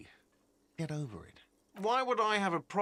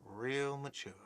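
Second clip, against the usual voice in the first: muttered repeat; 2 semitones lower, 8 dB quieter than usual. Acted. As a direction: mutter "Real mature" under the breath. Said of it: "a whiny voice shouts".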